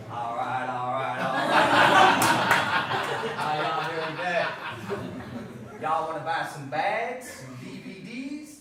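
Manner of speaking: slow American drawl, y'all and all; laid-back Southern salesman drawl; [name]'s own accent. Acted. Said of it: "do his own accent".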